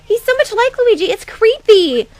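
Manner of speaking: very high, squealing disgust; disgusted fangirl. Acted. Fangirl creeped out by a supposed imposter, disgusted.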